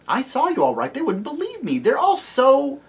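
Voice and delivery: put-on high, indignant little girl; high childlike complaint; girl's voice. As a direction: protest like a wronged child.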